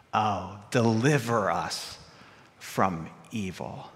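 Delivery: "Oh, deliver us from evil" slow, low and hushed; solemn emphasis. Shown as a demonstration. Preacher praying "deliver us from evil" as the promise, slow solemn emphasis. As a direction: weigh each word of "deliver us from evil", dropping low. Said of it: "that's where the emphasis belongs".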